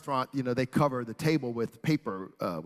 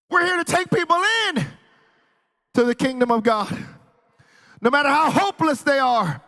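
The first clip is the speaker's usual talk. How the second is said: high, loud proclamation in bursts; raised preacher's voice, punching each phrase; full-force preaching; 13 semitones higher, 10 dB louder than usual. Real, not acted. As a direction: cry it out to the congregation.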